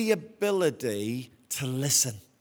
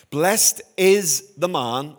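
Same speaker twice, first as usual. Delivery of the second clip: slow, measured, louder reading voice; scripture reading; 5 dB louder, much slower than usual. Acted. Pastor reads Psalm one to congregation, slow solemn reading. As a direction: lift the opening words of the psalm.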